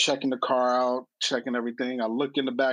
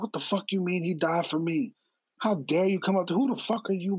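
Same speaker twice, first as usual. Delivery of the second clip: retold angry outburst; 6 semitones higher than usual. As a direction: replay the furious reply lightly, not shouting. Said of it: "so angry".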